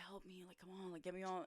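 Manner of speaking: very soft, under the breath; nonchalant plea. Retold or imitated. Hurt player casually signalling teammate, hushed nonchalant plea. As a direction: play it cool while quietly begging. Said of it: "trying to be, like, like, nonchalant".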